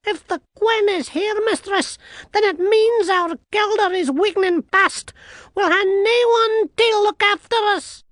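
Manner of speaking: very high, loud little-man voice; anxious tiny Scottish man. A narrator's reading. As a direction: explain in piping, worried Scots.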